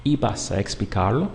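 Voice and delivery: "¿Ibas a explicarlo?" with the pitch rising at the end; question intonation. Shown as a demonstration. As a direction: ask "¿Ibas a explicarlo?" by the tune alone. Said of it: "changing the intonation of your voice into a question".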